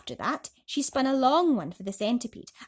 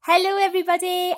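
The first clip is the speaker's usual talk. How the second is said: cheery greeting; 10 semitones higher, 11 dB louder than usual. Real, not acted.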